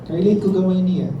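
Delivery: usual speaking voice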